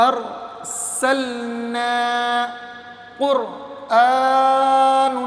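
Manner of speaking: very slow, drawn-out recitation of each word; teacher reciting the words aloud, slowly; Quran recitation. Shown as a demonstration. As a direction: chant each word long like tilawat. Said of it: "ابھی روا پڑھتے ہیں".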